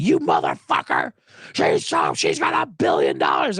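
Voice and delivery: low, strained, hammed-up rage; mock furious shout. Swift defenders attacking any critic, mock fury. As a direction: imitate an enraged fan, roaring it low.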